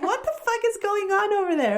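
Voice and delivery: high-pitched, incredulous retold outburst; baffled outburst. Wife retelling her reaction to husband's call, baffled outburst. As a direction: demand an explanation, high and bewildered.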